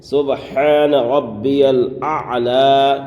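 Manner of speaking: very slow سبحان ربی الاعلی with a clear ha; سبحان ربی الاعلی drawn out carefully; correct recitation. Shown as a demonstration. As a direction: recite سبحان ربی الاعلی slowly, sounding every letter. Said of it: "صحیح تلفظ کیا ہے".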